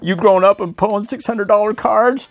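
high-pitched put-on gushing; hammed-up high voice; mock proud parent. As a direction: imitate a parent marvelling at their kid. Acts gushing parent over card.

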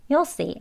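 YOU'LL as short "yuhl" before "see"; casual, contracted "You'll see"; reduced you'll. Shown as a demonstration. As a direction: say "You'll see" in two quick beats. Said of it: "간소한 발음".

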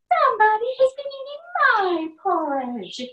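brisk mother bear; medium-sized voice. A narrator's reading. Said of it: "said Mama Bear in her medium-sized voice".

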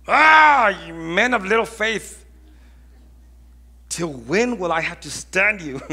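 exasperated cry, pitched high and loud; loud, fed-up rebuke opening on a big groan; ticked off. Acted. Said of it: "he would get pretty ticked off".

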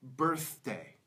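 "birthday" in two careful beats, clear th in the middle; middle th demo. Shown as a demonstration. Teacher models word with th in the middle, careful "birthday". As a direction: model "birthday" alone, holding the th before "day". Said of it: "a word that th comes in".

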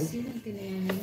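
whispering while hiding. Real, not acted. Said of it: "Huwag kang maingay".

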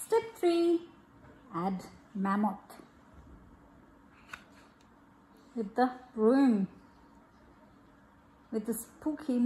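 gentle storybook reading, easy pace; reading to children. Acted. Mother reading a bath-time picture book to her child, gentle storybook voice. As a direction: read it aloud to a little one.

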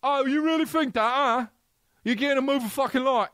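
high, snooty British dude; mock British actor. Actor imagining a snooty British rant at the lighting guy. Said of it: "I would do".

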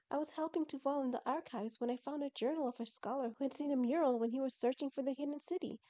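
put-on high-pitched, eager girl character; eager Shireen explaining.

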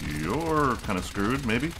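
"You're kinda screwed" drawn out slowly, rising; slow taunt. Real, not acted. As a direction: stretch every word to rub it in. Taunts trapped enemy.

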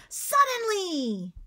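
drawn-out excited shout, high and loud. Shown as a demonstration. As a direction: belt it out with big excitement. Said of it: "you can say it very excitedly".

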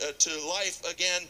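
usual speaking voice

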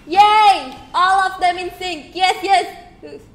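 loud, high cheers; cheering shout. Real, not acted. Streamer cheering the dancing cats, loud and high. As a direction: cheer them on at full voice.

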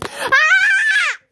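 high, loud shocked scream; startled shriek. Acted. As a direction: scream as the camera breaks. Breaks the camera.

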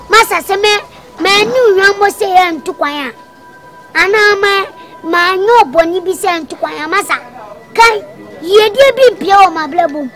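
high, loud bragging; excited boasting. Acted. Suitor boasting to the father, loud and excited. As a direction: brag to win the father over.

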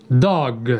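ordinary talk

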